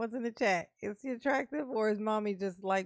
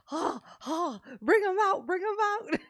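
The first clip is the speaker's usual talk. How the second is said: excited; 8 semitones higher, 5 dB louder than usual. Real, not acted. Excited laughing cheer at court.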